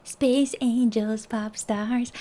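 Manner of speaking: mock-dreamy whisper. Chanting affectionate names for the group.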